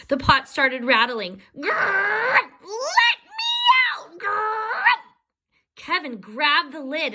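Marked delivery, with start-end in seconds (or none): 1.6-4.8 high growling shout to get out; high, furious little creature; angry trapped leprechaun. A narrator's reading. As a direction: growl and yell from inside the pot.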